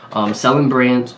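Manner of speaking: ordinary talk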